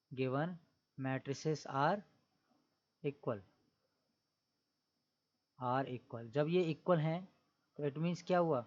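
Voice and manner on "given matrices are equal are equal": given matrices are equal spoken word by word with long pauses; slow dictation. Shown as a demonstration. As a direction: dictate it slowly so students can write. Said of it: "तो लिखोगे". (धीरे-धीरे बोलकर लिखवाना)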